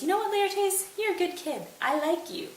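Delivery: high, oily flattering voice; butter him up in a high sly voice; smarmy Claudius. Retold or imitated. Claudius to Laertes, high smarmy flattery.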